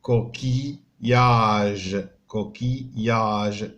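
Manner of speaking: كوكياج said slowly, syllables pulled apart; teacher splitting the word; syllables split. Shown as a demonstration. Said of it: "كنفرقوا بيناتهم".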